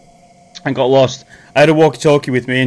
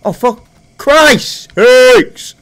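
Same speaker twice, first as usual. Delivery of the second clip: loud, much higher exasperated cry; panicked curse; 8 semitones higher, 7 dB louder, much slower than usual. Real, not acted. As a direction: shout it in sudden panic. Panic at zombie attack.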